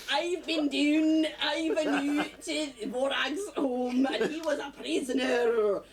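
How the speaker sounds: slow, laughing Scottish impression; bad Scottish accent. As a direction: drag it out in a hammed-up Scottish voice. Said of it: "bad Scottish accent".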